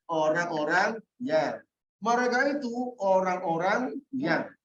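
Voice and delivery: slow, deliberate translation; slow explanatory reading. Shown as a demonstration. Teacher slowly giving the translation. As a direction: spell out the meaning slowly. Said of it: "Kalau alladzina".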